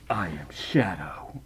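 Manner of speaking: a slow, breathy whisper of the name; mock-ominous whisper; whispered Shadow. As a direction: hush it like a dramatic reveal. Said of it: "I'm gonna stop right now".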